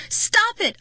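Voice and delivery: high, loud furious scream. Acted. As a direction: scream at the werewolves to stop. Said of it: "I screamed".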